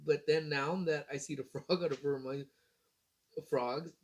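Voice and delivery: usual speaking voice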